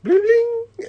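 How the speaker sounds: very high electronic bling; imitated message alert. Mimicking an instant message popping up, high bling. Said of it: "there would be an instant message".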